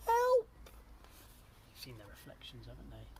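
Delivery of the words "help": very high, loud yelp; panicked cry. A narrator's reading. Other sister panics at the water, shrieked cry. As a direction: shriek it for rescue. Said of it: "and the other cried out".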